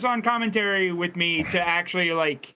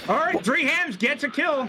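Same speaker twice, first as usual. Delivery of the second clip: high, excited announcer call; excited commentary; 6 semitones higher than usual. Real, not acted. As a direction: hype up the race result. Hyped race commentary.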